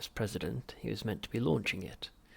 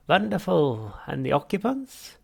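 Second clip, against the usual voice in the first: much higher, louder unctuous therapist; Halfrunt voice; 7 semitones higher, 10 dB louder, slower than usual. Acted. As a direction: greet the patient with oily cheer. Psychiatrist voiced.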